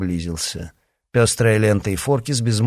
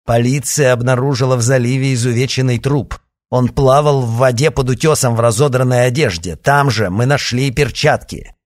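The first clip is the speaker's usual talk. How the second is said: loud, higher reporting voice; news report read aloud; 4 semitones higher, 7 dB louder than usual. Acted. Reading a newspaper report aloud.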